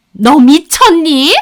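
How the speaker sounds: loud, slow incredulous question; aghast. Acted. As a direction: drag it out in outraged disbelief. Mother-in-law aghast at overturned table.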